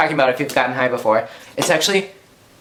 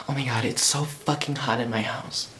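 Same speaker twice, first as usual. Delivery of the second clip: quiet; 4 semitones lower, 11 dB quieter than usual. Real, not acted. Sighs, complains about heat.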